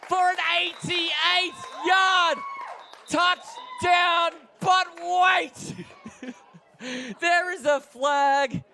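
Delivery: very high shout, stretched at the climax; excited play-by-play. Real, not acted. Commentator calls a long return until the flag, excited shout. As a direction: build to a full roar as he breaks free.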